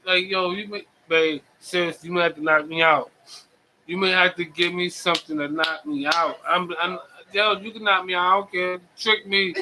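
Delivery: ordinary delivery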